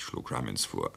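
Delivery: ordinary delivery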